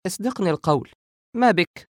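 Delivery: high, insistent, anxious plea; still worried. A narrator's reading. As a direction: press for the truth, high and concerned. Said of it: "والقلق لا يزال يخالجها". (قلق وإلحاح بصوت عال)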